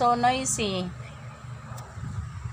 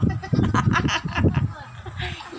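high, drawn-out laughter; laughing; 6 semitones higher than usual. Real, not acted. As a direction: keep cracking up. Laughing at the cow.

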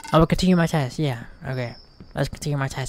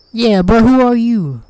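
slow shouted who are you; defiant yell; loud challenge; 6 semitones higher, 8 dB louder than usual. Real, not acted. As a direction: call out the stranger. Yelling at texter.

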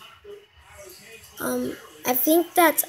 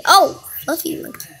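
higher, louder delighted aww; delighted cooing at a fluffy animal; 5 semitones higher, 7 dB louder than usual. Real, not acted. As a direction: gush over something cute.

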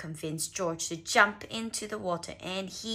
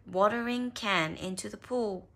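ordinary delivery

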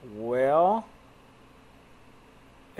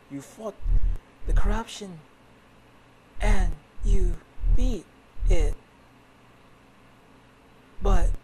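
woman's voice; 5 semitones higher, 6 dB louder than usual. Acted. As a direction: gently tell him he won. Voicing the woman character.

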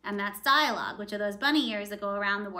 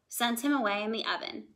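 usual speaking voice

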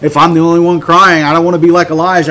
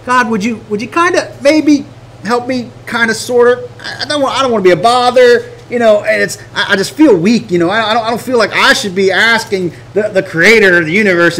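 mock-timid plea, halting and higher; timid, hesitant prayer; 3 semitones higher than usual. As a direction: hedge and stammer like a meek petitioner. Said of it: "He ain't bold when he goes".

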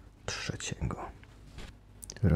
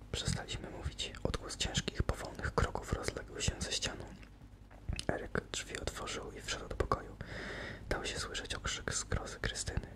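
hushed storytelling whisper; whispered narration; 14 semitones lower, 10 dB quieter than usual. A narrator's reading. Narrator telling the captives' plight in a whisper. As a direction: breathe the tale in a tense hush.

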